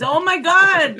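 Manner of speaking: exasperated outburst at his fidgeting. Real, not acted.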